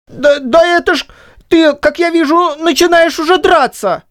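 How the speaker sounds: stepping back, voice jumping up; high, startled disguised voice; backing off, alarmed. A narrator's reading. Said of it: "произнёс он, совсем отступая".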